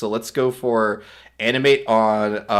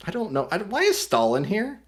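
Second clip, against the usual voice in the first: ordinary delivery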